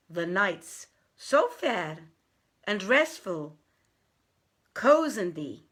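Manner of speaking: measured verse, lightly lifted in places; a wazir quoting a poet to the king; poem recited. Acted. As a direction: recite the poet's warning solemnly. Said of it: "saith the poet".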